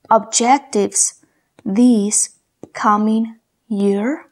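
very slow, word by word; words spaced out for a listening test; slow dictation. Shown as a demonstration. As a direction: dictate each word with pauses. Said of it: "Yo te voy a dictar".

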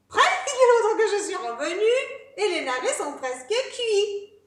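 high, loud, quick boast; perky piglet voice; cheeky little pig. Acted. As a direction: rattle it off high and triumphant.

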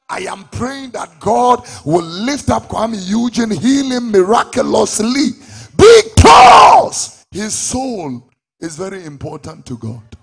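building to a loud, slow shouted peak; preaching at full force. Real, not acted. Preacher crying out in prayer for [name]. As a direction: lift it up until it roars.

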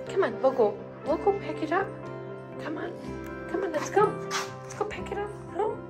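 high, light coaxing voice; coaxing a pet. Real, not acted. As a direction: urge the dog along in a high voice. Coaxing the dog along.

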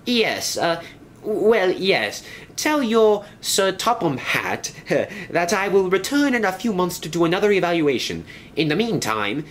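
a fussy railway inspector laying down the law; high-pitched stern inspector. Acted. Inspector continues.

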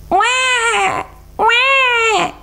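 put-on high-pitched baby wail; long, high mock baby cry; imitated baby crying. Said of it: "It's a baby's voice".

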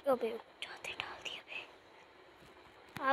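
annoyed whisper under the breath; muttered whisper to himself; whispered aside. Real, not acted. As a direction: hiss it to yourself after a slip. Muttered aside after mistake.

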